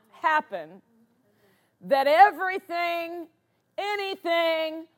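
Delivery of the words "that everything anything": raised, punched declaration, word by word; emphatic preaching. Real, not acted. Preacher declares God's promise to the congregation, emphatic and raised. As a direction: drive each phrase home to the congregation.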